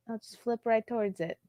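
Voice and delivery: ordinary talk, nothing marked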